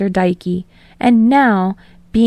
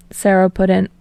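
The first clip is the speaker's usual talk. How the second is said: plain reading voice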